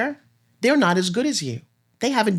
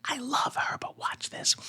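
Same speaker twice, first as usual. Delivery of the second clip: conspiratorial whisper; whispered aside; 5 semitones higher, 11 dB quieter, slower than usual. Real, not acted. Coach aside to viewers about her guest, whispered. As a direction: confide it to the audience under your breath.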